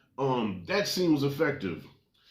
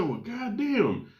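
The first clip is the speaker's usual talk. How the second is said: slow awed reaction; 7 semitones higher, much slower than usual. Retold or imitated. Reacting to demon makeup.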